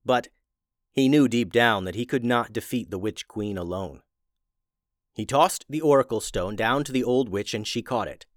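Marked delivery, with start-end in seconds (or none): none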